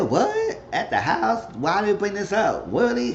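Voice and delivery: high-pitched incredulous questioning; squeaky-high disbelief, playing it up; mock invested listener. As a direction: imitate someone hooked on juicy gossip.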